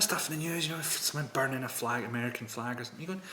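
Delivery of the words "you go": ordinary talk, nothing marked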